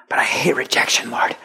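whispered, small and reluctant; timid whisper. Acted. Believer whispering his fear to the Lord. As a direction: confess it under your breath.

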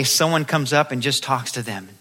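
ordinary delivery

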